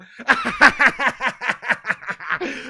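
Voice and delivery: long, loud, high cackle; uproarious mocking laughter; gloating laugh. Real, not acted. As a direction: cackle helplessly after the rant. Laughs after his rant.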